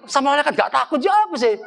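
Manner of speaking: normal talking voice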